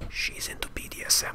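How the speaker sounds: whispered cheeky aside; whispering. Real, not acted. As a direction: whisper it like a naughty secret. Cheeky aside about unit lore.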